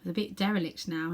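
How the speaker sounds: ordinary delivery, nothing marked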